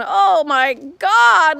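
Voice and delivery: slow, loud, drawn-out dismay; overwhelmed. Real, not acted. Homeowner seeing overgrown bushes, loud drawn-out dismay.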